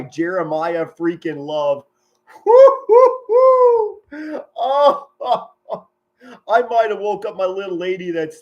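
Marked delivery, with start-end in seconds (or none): 2.4-4.4 loud, very high celebratory "woo"; shrieked triple whoop of joy; excited whooping. Real, not acted. Celebrating a long touchdown run. 4.6-5.9 high, loud "oh" breaking into laughter; real delighted cackle after whooping; elated laugh. Real, not acted. Elated after the touchdown run.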